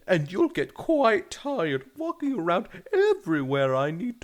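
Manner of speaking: lightly British mum, rising high; soothing British mother, rising. Acted. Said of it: "a female doing a British accent".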